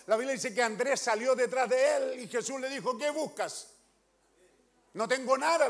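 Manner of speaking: loud fervent sermon delivery; preaching at full force. Real, not acted. Preacher retelling Andrew meeting Jesus, fervent and loud. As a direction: proclaim it to the whole church.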